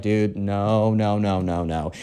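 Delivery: low, soft string of no's; deadpan refusal. Acted. Caroline rejects the lovesick kid, low deadpan refusal. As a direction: turn them down low and soft, sinking away.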